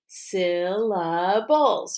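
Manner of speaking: "Syllables" slowly, split into three beats; tapped syllables. Shown as a demonstration. Teacher tapping with pupils, "Syllables" said beat by beat. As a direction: break "Syllables" into syl, la, bles. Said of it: "Let's tap it".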